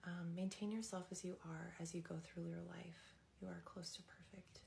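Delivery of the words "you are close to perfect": soft, voiceless whisper. Real, not acted. Telling the viewers they are close to perfect, whispered. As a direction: breathe the words with no voice.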